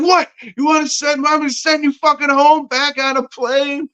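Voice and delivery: high-pitched angry snap at Lori; snapping. Retold or imitated. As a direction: yell it out shrill and fed up. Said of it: "I just snapped".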